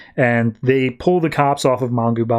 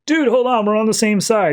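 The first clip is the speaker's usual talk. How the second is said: much higher, alarmed mock plea; choked-up hero protesting, pitched way up; panicked Batman; 9 semitones higher than usual. Batman protests while Superman grabs his neck, panicked and high. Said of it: "and Batman's like".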